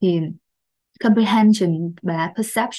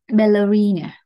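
"ballerina" as "ba-lə-REE-na", loud and falling; stress on third syllable; 6 dB louder than usual. Shown as a demonstration. Teacher modelling a tricky word, "ballerina" stressed on the third syllable. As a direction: lean firmly on "REE" in "ballerina". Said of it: "trọng âm rơi vào âm thứ ba".

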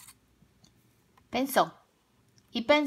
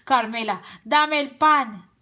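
loud, urgent wolf demand; 3 semitones higher, 7 dB louder, slower than usual. A narrator's reading. Tío Lobo demanding bread from Carmela, loud demand. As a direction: demand it loudly. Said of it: "sin parar pidió".

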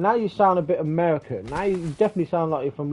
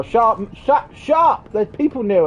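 cutting in, rising to a loud higher shout; urgent shut-up; 6 semitones higher, 4 dB louder than usual. Real, not acted. Warning teammate that people are near, urgent shout. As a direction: shut them up, each try louder and higher.

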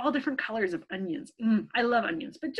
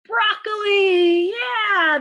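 high, loud, slow answer; excited reveal; 8 semitones higher, 8 dB louder, slower than usual. Acted. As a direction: announce the answer with delight.

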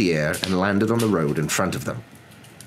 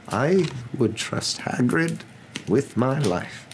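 slow, deliberate, pitched up; slow assurance; 8 semitones higher, much slower than usual. A narrator's reading. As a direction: vouch for him, weighing every word. Dumbledore vouches for his friend.

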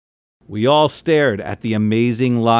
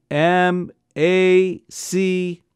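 very slow, letter by letter; spelling out; 6 semitones higher, much slower than usual. A narrator's reading. Father spelling Maccabees for the colonel, slowly.

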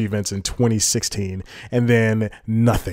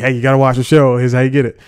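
loud, higher-energy hard sell; mock promo pitch; 3 semitones higher, 9 dB louder, faster than usual. Vlogger imitating networks pushing a show, loud mock pitch. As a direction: pitch it loudly like a pushy promoter.

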